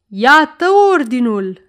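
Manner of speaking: loud, lifted cry of victory; triumphant shout. Acted. A hundred voices waving the order at the count, triumphant shouting. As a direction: shout it out as a jubilant crowd. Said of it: "strigară o sută de glasuri triumfătoare".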